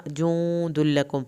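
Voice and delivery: جند لكم with the n nasalized and held; لكم recited slowly, nasal ghunna held. Shown as a demonstration. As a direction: let the ghunna ring through the nose in جند. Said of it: "یہاں پہ غنہ ہوگا، دیر لگے گی".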